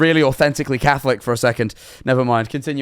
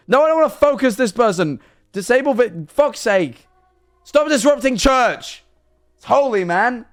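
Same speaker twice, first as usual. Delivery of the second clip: raised, high-pitched exasperation; flustered, high-pitched scolding; exasperated outburst; 9 semitones higher than usual. Real, not acted. Exasperated at disruption of church.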